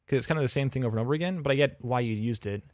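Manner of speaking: normal talking voice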